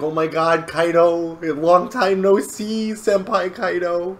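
high-pitched fangirl gushing; swooning Ulti. Retold or imitated. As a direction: gush over a crush, hammed up. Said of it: "Ulti's swooning over Kaido".

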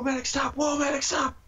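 high yelp for the medic; high panicked call. Real, not acted. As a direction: cry out for the medic.

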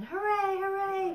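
high, loud hooray; joyful cheer. A narrator's reading. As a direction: cheer like toys celebrating at the top. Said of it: "cried the little clown".

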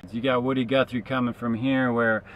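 ordinary talk

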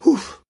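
loud, very high Ouf of relief; girl's relieved exclamation; relieved gasp. Acted. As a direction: let the whole fright out in one breath. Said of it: "s'exclama Polly, soulagée".

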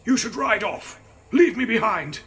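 strained, high, loud croak of a man in pain; croaking injured man. A narrator's reading. Injured coachman urges them off.

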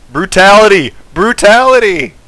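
loud, high, gleeful proclamation; mock announcer shout. Player crows over a game kill, triumphant shout. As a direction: bellow it like a victory call.

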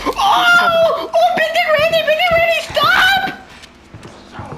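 very high, wailing plea; panicked scream. Real, not acted. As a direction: shriek for it to stop. Monster chasing player.

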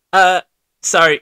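ordinary talk, nothing marked

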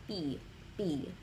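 P with no puff of air, close to B; unaspirated P. Shown as a demonstration. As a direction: say P holding back all the air. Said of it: "no air that comes out".

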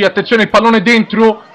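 high, loud goal call shouted breathlessly; breathless italian goal commentary. Real, not acted. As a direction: scream the goal, rising with excitement. Commentator calls a goal live.

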